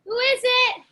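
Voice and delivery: loud, high call toward the door; startled call. Acted. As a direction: caught off guard, call out who's there. Startled by knock, calls out.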